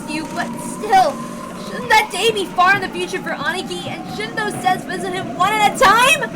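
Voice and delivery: grieving Battler railing at fate; bitter young man. Acted. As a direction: argue against fate in pain. Battler voiced in the story.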